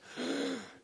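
high-pitched mock gasp; panicked gasp. Preacher acting out when a crisis hits, panicked gasp. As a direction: gasp as if a crisis just hit. Mimics reaction to sudden crisis.

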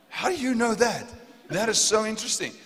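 high-pitched astonished reaction; amazed. Retold or imitated. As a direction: marvel at what the stranger knows. Retold amazement at stranger's knowledge.

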